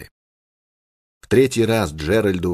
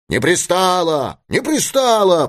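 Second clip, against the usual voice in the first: high, slow chant of protest, second one higher; crowd taking up the cry; 11 semitones higher than usual. A narrator's reading. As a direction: echo the shout, stretched out and rising. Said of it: "подхватили другие". (толпа подхватывает: протяжный высокий выкрик)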